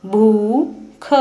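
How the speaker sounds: भूख said very slowly; slow spelling-out. Shown as a demonstration. Teacher slowly sounding out भूख. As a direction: draw भूख out slowly first. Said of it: "लंबे समय तक और ऊंचे स्वर में पढ़ा".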